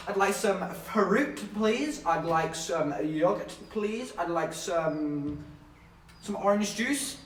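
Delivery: slow, halting order with rising ends; drawn-out, overly careful customer; mock-polite ordering. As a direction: order item by item, each please lifted. Acting out his breakfast order.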